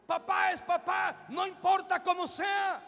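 loud, high, slow hammering of each phrase; fiery pulpit exhortation, every line pounded; preaching at full force. Acted. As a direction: thunder the warning at the young people. Pastor exhorts the youth.

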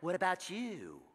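low, hushed tempter's voice; soft, low imitation of a whisper; mock Satan whisper. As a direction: murmur it like a sly temptation. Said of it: "Satan whispered in my ear".

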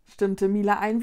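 ordinary delivery, nothing marked

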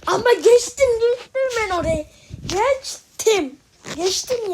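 loud, high protest at the game; indignant protest. Real, not acted. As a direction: insist you made it, outraged. Insisting he cleared the level.

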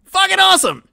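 loud, very high triumphant cry; ecstatic shout. Real, not acted. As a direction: yell it again just as hard. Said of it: "my official stance".

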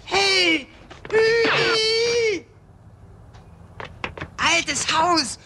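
high, loud joyful greeting; excited shout. Acted. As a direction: shout his name with delight. Wiedersehen mit dem Freund. (freudig aufgeregter Ausruf)

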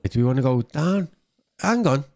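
ordinary delivery

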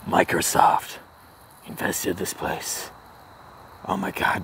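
hushed whisper, slow; conspiratorial mock whisper. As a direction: sneak it out under your breath. Sneaking near closed store.

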